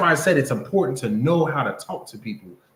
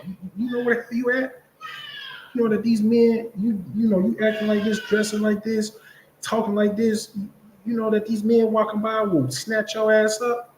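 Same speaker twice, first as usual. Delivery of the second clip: higher, pressing warning to a sister; urgent warning; 7 semitones higher than usual. Retold or imitated. Brother warns a sister at the mall, urgent and pitched up. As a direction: press the danger on her, pitched up.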